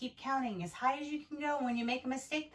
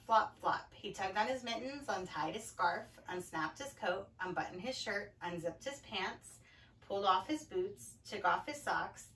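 lower, winding-down story voice; low storybook narration, slowing at the end; reading to children; 4 semitones lower than usual. A narrator's reading. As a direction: settle the story toward bedtime. Reads picture book to children.

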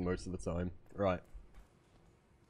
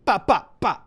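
loud, high "ba" bursts; imitated gunfire; 10 semitones higher, 14 dB louder than usual. Gamer acting out firing at someone who wasn't there, loud mock gunfire. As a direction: shout out pretend gunshots in sharp bursts. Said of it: "I was gonna go".

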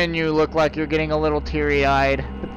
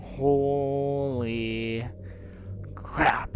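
shaken hush; 5 semitones lower, 8 dB quieter, much slower than usual. Real, not acted. Shaken after jump scare.

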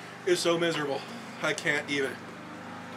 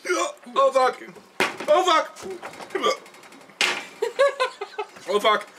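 high, loud cries of burning pain; 12 semitones higher, 6 dB louder, much slower than usual. Real, not acted. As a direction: yelp through the burn.

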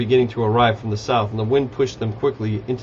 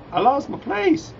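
high, drawn-out silly whine; mock wail; 13 semitones higher, slower than usual. Real, not acted. Reader losing place in the story, mock wailing. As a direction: wail it slowly in a tiny high voice.

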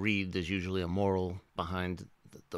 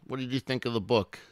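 usual speaking voice

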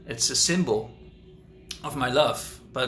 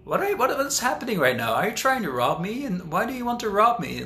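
much higher, startled and wide-eyed questions; mock baffled surprise; 8 semitones higher than usual. Facing a street robber, much higher baffled surprise. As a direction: sound genuinely puzzled at being robbed. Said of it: "and you're like".

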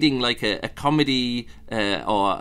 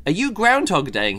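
mock accusing question; 6 semitones higher than usual. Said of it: "someone turns to him and goes".

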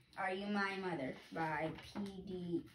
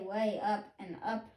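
usual speaking voice

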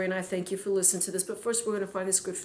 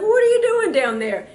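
startled outcry; 11 semitones higher, 9 dB louder than usual. Retold or imitated. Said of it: "and he's like".

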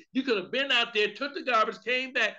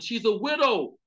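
ordinary talk, nothing marked

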